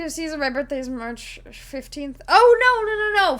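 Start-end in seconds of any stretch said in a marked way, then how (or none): 2.3-3.4 sudden realization. Real, not acted. Suddenly corrects who gifted the sub.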